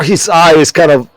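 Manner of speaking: usual speaking voice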